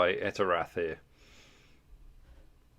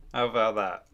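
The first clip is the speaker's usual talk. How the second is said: ordinary delivery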